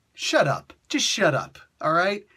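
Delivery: a joking snap at the viewer; mock defensive. Joking defensively about crying.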